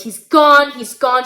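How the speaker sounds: loud burst, dropping away on each phrase; acted screaming grief. Daughter to mother about dead dad, acted screaming grief. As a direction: cry it out as if screaming, no real sobs. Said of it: "She's screaming, crying".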